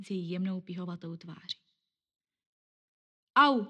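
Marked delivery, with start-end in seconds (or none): 3.4-3.7 loud, high yelp of pain; sudden yelp. Acted. Boy hit in the face by a ball, loud high yelp. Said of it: "vyjekl najednou nějaký chlapec".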